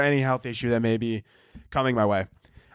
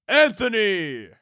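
slow, drawn-out call from above, higher pitched; booming God call; 7 semitones higher, slower than usual. Pretend. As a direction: call his name slowly, like God from the heavens. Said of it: "Let's retry that".

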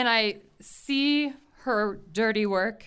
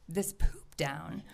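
whisper; 9 semitones lower, 12 dB quieter, slower than usual. Retold or imitated. Whispered to herself.

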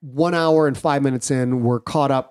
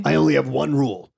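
ordinary talk

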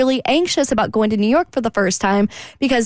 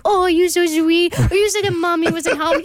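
cat voice; 7 semitones higher than usual. Retold or imitated. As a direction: coo at the cat. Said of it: "I do this at home with my cats too".

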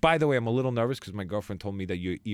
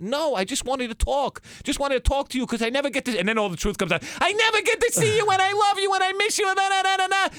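very high, louder, pleading mom voice; 6 dB louder than usual. Retold or imitated. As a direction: let the mother's guilt trip pour out, pitched way up. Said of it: "I said".

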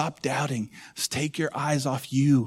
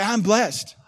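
raised, high-pitched call; a bit louder; 7 semitones higher, 7 dB louder than usual. Shown as a demonstration. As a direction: lead the congregation a little louder. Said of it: "say it a little bit loud".

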